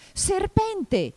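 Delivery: very high, loud cry; alarmed shout. A narrator's reading. Pigeon cries out at Alice's long neck, high alarmed shout. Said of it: "gridò il colombo".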